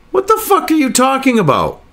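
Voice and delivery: ordinary talk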